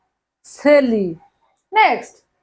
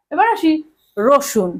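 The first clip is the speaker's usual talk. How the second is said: ordinary delivery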